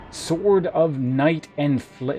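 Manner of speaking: slow, grand title reading; epic name announcement. Pretend. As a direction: draw out the weapon's name majestically. Dramatic reading of weapon name.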